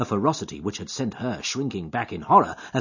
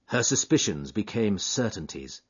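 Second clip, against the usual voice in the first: ordinary talk, nothing marked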